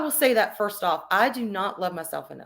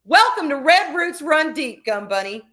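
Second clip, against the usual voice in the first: excited welcome; 5 semitones higher, 7 dB louder than usual. Real, not acted. Welcoming a viewer to the club.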